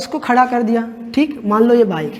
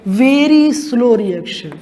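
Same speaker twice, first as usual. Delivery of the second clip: stretched slow; much slower than usual. Shown as a demonstration. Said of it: "दूसरी type की reaction क्या होती है?".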